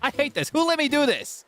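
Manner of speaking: high-pitched hammed-up exasperation; rising theatrical blame; mock tantrum. Streamer hating the build and blaming chat, mock tantrum.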